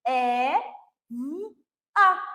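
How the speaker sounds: "E-V-A" split into three separate sounds; segmenting the word Eva for a child; sounding out letters. Shown as a demonstration. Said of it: "Eu quero que ela veja que Eva é".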